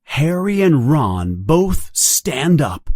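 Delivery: ordinary delivery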